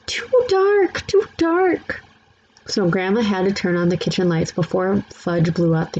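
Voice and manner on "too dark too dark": frightened cry. A narrator's reading. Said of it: "Sam cried".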